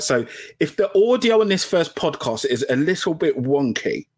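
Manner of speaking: ordinary delivery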